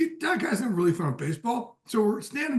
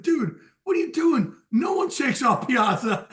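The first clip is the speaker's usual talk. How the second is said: higher and louder, laughing disbelief; loud laughing disbelief, slower at the end; incredulous mock scold; 6 semitones higher, 5 dB louder than usual. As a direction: scold him while cracking up. Retold scolding of teammate, laughing.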